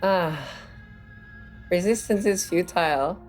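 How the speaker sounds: normal talking voice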